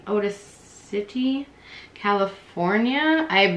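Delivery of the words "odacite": slow, soft "Odacite" as oh-duh-sit-ee; half-under-the-breath "Odacite" as oh-duh-sit-ee; trailing off on a last slow attempt at "Odacite"; third try, softer. Shown as a demonstration. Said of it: "sorry if I butcher this".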